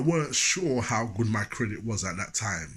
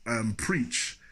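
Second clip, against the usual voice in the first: ordinary delivery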